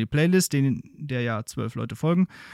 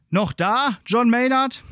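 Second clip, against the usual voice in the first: calling out; 10 semitones higher, 4 dB louder, slower than usual. Acted. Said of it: "durchs Sprachrohr fragt er an".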